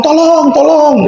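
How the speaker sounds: high, slow mock cry for help. Robbery victim crying out for help, slow and high. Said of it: "teriak dong".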